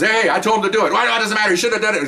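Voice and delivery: animated mock protest, high; acted protest. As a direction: imitate a boss butting in to argue. Boss protesting in retold quarrel.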